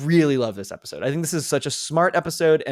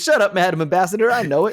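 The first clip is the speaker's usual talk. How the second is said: laughing quote; 6 semitones higher, 3 dB louder, faster than usual. Retold or imitated. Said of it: "and he goes".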